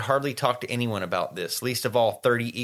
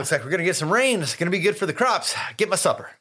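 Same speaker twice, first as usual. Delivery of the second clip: quick, higher-pitched cheerful farmer; happy Pa; 6 semitones higher, faster than usual. Retold or imitated. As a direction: imitate Pa rattling off good news to his wife. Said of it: "really happy because the wheat's doing good".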